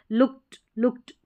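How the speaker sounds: "लुक्ड" said lukt, final t; "लुक्ड" in one syllable ending in t; looked with t ending. Shown as a demonstration. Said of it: "ट sound निकल कर आ रहा है".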